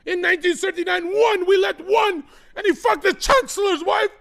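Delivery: high, loud comic rant; hammed-up shouting rant. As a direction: yell it like a furious orator. Ranting opening bit, shouted.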